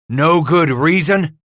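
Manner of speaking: loud, high, drawn-out angry retort; outraged shriek. A narrator's reading. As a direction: throw the words back in outrage. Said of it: "Cooper shrieked".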